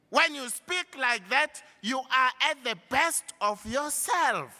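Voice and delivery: loud, high, impassioned preaching; rally them with full force. Real, not acted.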